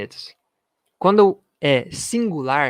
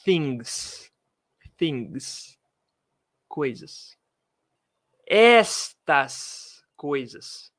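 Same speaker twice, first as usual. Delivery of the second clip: very slow, stretched out; slow teacher emphasis; much slower than usual. Shown as a demonstration. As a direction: spell out the meaning slowly. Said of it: "teacher stresses translation".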